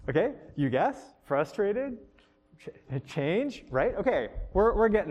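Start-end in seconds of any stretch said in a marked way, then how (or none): 0.5-2.0 "you guess" and "Frustrated" each lifted into a question; questioning echo. Shown as a demonstration. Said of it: "just asking it in question form". 3.1-3.6 "change" with a clear rising end; questioning echo. Shown as a demonstration. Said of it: "just asking it in question form".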